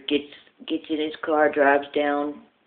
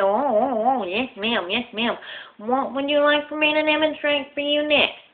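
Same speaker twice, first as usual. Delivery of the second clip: flustered lisping salesman; 9 semitones higher than usual. Acted. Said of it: "he talks with a lisp".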